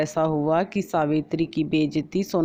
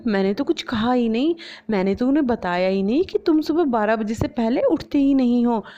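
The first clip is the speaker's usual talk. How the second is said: higher, innocent-sounding mocking voice; taunting young bahu; 6 semitones higher than usual. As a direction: feign innocence while listing faults. Bahu taunts sister-in-law. (भोली बनकर ताना मारती बहू)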